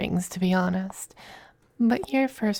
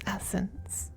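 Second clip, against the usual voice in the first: the word breathed out very softly; sultry whisper; 11 dB quieter than usual. Acted. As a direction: savour it, almost inaudible.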